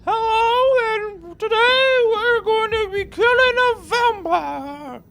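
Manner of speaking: very high, loud mock posh British accent; exaggerated British squeal, slow and shrill-high; full British accent. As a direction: camp British presenter voice, hammed up. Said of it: "if I was going full British".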